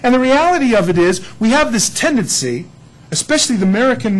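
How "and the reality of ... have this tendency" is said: ordinary talk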